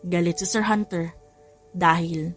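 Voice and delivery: plain reading voice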